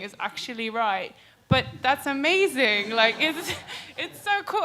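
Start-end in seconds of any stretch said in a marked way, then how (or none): 1.5-4.6 laughing excitedly. Real, not acted. Excited about her job.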